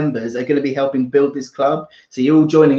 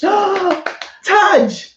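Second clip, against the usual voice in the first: gasp, then high-pitched name; excited surprise; 14 semitones higher than usual. Real, not acted. Recognising a name from old days.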